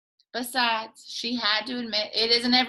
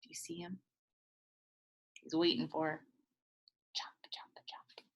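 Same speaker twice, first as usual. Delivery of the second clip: whisper; 6 semitones lower, 13 dB quieter than usual. Real, not acted. Whispered aside, alligator warning.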